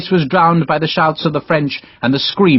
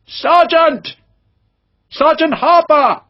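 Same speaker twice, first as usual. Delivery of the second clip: calling out; slower than usual. Acted. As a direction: shout through cupped hands for a lost sergeant. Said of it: "He cupped his hands".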